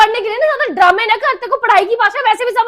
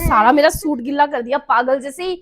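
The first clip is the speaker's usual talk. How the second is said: ordinary delivery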